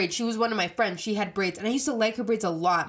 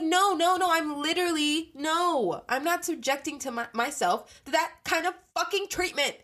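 defiant refusal; 8 semitones higher than usual. Retold or imitated. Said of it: "I'd be like".

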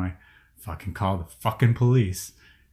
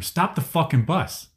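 retold command to stop; 7 semitones higher, slower than usual. Retold yelling at bus driver.

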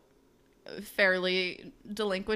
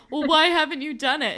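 louder, higher pushy bro voice; 6 semitones higher, 7 dB louder than usual. Retold or imitated. Retelling a show, teen boy pushes his friend, loud and higher. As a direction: push him hard, mock dude. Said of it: "Moose is like".

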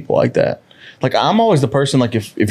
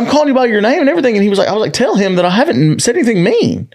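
indignant protest; 10 semitones higher, faster than usual. Retold or imitated. Protesting the teacher's accusation, retold.

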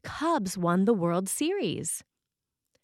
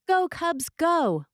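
high, louder cheer of Go Cubs; 10 semitones higher, 5 dB louder, slower than usual. Pretend. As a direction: call it out like a fan in the stands. Chalk message cheering the team.

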